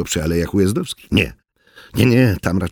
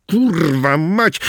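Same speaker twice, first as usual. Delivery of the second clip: cursing; 6 semitones higher, much slower than usual. Acted. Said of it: "Oriński klął".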